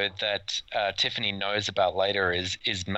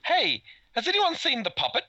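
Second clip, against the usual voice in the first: higher-pitched acted question; mock casual wondering. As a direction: call out a sudden mock query. Acting the family noticing too late.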